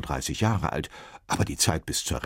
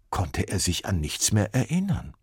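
usual speaking voice

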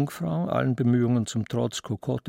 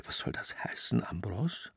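hissed whisper, much softer; hissing; 4 semitones lower, 8 dB quieter than usual. Acted. Aunt challenging her nephew in the saloon, hissing. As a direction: whisper it as a hushed reproach. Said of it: "Juliana zischte".

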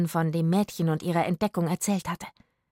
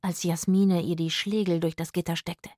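plain reading voice

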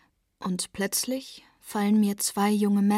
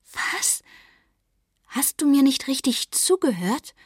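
higher and louder, stunned disbelief; incredulous outburst to her boyfriend; aghast; 6 semitones higher, 5 dB louder than usual. Acted. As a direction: she can't believe what he just said. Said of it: "Ich bin völlig entgeistert".

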